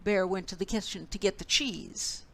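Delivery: ordinary delivery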